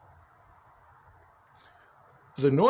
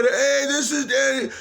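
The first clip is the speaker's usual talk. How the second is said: raised, lightly put-on voice announcing itself; the voice claiming Danny; 7 semitones higher than usual. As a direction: give the intruding voice a light higher tone. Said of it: "And the voice said".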